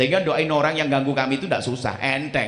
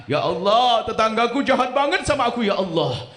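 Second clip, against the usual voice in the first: higher, louder complaining prayer; mock whiny prayer; 7 semitones higher, 4 dB louder than usual. Preacher mocking a worshipper complaining about the neighbour, higher and louder. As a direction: whine it up to God.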